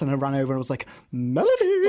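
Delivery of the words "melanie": calling out. Retold or imitated. Runs over calling to [name].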